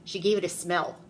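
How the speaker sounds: ordinary delivery, nothing marked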